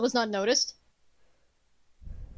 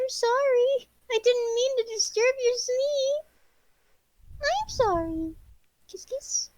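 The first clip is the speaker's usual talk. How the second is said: soothing the cat; 9 semitones higher than usual. Real, not acted. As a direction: coo an apology to a startled kitten. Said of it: "so I was like".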